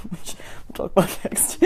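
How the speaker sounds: whispered aside. Real, not acted. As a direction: whisper it as a secret. Aside teaser.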